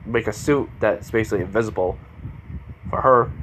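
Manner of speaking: usual speaking voice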